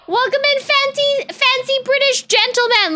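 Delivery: high, hammed-up posh British welcome; lilting toff voice bowing to guests; mock posh greeting. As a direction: greet them like a fancy gentleman. Greeting fancy gentlemen pins.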